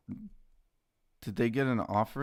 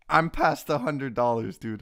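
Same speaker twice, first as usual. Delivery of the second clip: excited; 3 semitones higher, 8 dB louder than usual. Real, not acted. Stock crosses a hundred dollars.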